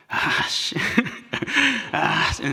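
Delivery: unvoiced, irritated curse under the breath; muttered annoyance. The annoyed walker in the rain, hushed cursing. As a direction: grumble it through your teeth.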